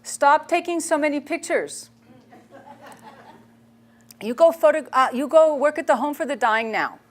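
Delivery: a lightly voiced stern nun giving orders; Mother Teresa's command. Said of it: "she said".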